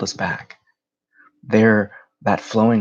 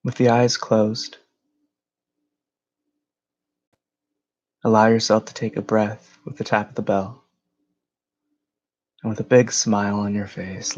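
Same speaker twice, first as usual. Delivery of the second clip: unhurried phrases with long pauses; guided meditation. Acted. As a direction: guide them gently into a breathing exercise.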